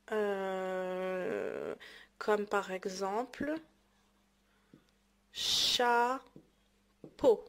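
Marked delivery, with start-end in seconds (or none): 5.3-7.4 "chapeau" drawn out with a long gap mid-word; syllable by syllable. Shown as a demonstration. Teacher dictating an example of O, syllable by syllable. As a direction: stretch the first syllable of "chapeau", pause, then finish. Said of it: "تقطع الكلمات كيتسمى سيلاب".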